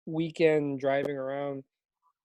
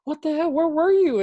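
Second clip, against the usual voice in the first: shocked dad; 10 semitones higher, 6 dB louder than usual. Retold or imitated. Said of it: "I was like".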